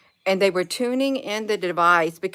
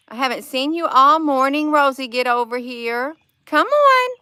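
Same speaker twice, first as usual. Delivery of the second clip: much higher, louder sing-song pet call; calling her pet; 8 semitones higher, 7 dB louder than usual. Real, not acted. Calling her pet to come eat, high loud coaxing call.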